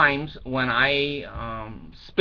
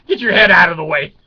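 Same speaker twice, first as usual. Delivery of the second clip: loud, fast retold shout; retold yell; 4 semitones higher, 12 dB louder, faster than usual. Dad on a ladder yelling down at the kids, loud and fast.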